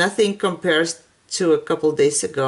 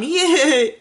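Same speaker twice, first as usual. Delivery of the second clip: higher little yay of delight; happy cheer; 8 semitones higher than usual. Real, not acted. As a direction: cheer for the find. Cheer that the store had some.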